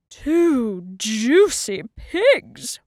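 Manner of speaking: slow, loud relishing wolf; crying out. A narrator's reading. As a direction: savour it out loud, drawn out. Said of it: "Wolf cried".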